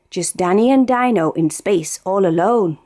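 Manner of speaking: ordinary delivery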